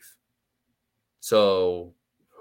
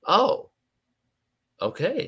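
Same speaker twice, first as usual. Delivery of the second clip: surprised; 13 semitones higher, 3 dB louder, slower than usual. Retold or imitated. Dad hears he has mean voice.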